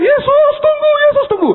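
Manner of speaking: very high, very loud shouted plea; shouting after him. Retold or imitated. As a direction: scream for him to wait. Marta chasing Jesus, calling. (berteriak sangat keras dan tinggi memanggil)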